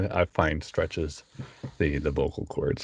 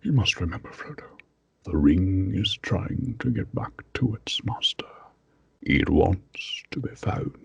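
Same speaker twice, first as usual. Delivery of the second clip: slow, hushed wizard warning; Gandalf impression. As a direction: whisper it gravely like an old wizard. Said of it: "and then there's Gandalf".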